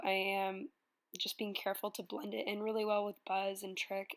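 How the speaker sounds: usual speaking voice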